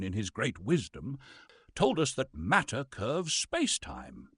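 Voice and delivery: ordinary talk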